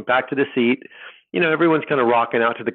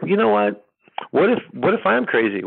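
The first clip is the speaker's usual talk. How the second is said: higher, rising worry; anxious doubt; 8 semitones higher than usual. Retold or imitated. Telling wife he might be crazy, higher anxious voice. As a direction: let the fear creep up into the pitch. Doubting his sanity, quoting himself.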